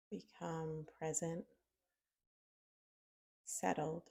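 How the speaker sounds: unhurried meditation guide with long pauses; soft-spoken, spacious mindfulness prompt; guided meditation. Acted. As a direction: guide them gently inward, leaving long silences.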